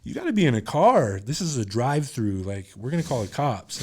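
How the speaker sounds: high, loud warning; stern shout. Retold or imitated. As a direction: yell through the drive-thru window. Drive-thru worker scolding.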